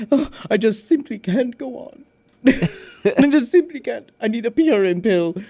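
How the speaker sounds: high dramatic sigh; theatrical whine. Retold or imitated. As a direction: wail it like a diva. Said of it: "He's like".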